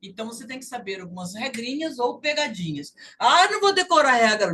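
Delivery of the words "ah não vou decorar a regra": louder, higher, quick protest; complaining student. Acted. Teacher mimics student refusing to memorise the rule, complaining. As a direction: whine like a student refusing homework.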